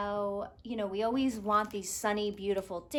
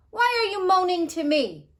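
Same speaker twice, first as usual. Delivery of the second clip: king shouting; 9 semitones higher, 11 dB louder than usual. Acted. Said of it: "shouted the king".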